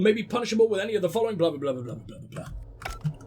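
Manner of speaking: rushed, then fading away into mumbled blahs; dismissive skimming. Acted. Reader bored of the automated notice, dismissive fade-out. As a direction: race off and let it peter out.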